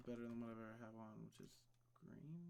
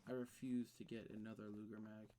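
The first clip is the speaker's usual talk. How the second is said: ordinary talk, nothing marked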